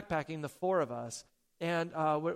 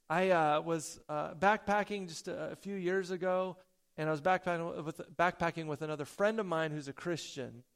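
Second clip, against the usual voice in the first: usual speaking voice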